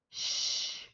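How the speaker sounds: "sh" held long, without voice; isolated SH sound. Shown as a demonstration. Teacher giving the correct pronunciation, long whispered "sh". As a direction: sustain "sh" as one soft hushing sound. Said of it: "The correct pronunciation is".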